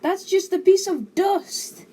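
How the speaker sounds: high, loud, drawn-out objection; slow, indignant kid's complaint; protesting. A narrator's reading. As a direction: protest to Mum in disbelief. Son protests to nagging mother.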